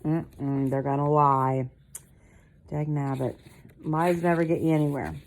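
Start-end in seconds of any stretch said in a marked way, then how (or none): none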